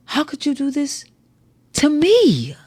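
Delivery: high, pleading take stressing "you". Shown as a demonstration. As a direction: lift it high, land hard on "you". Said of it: "when altering the emphasis on a word".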